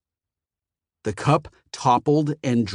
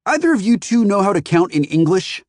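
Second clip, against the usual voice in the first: raised, sharp demand; irritated customer; 9 semitones higher, 6 dB louder than usual. A narrator's reading. Phoenician snaps at the store clerks, irritated. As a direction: snap at the clerks. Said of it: "said the Phoenician".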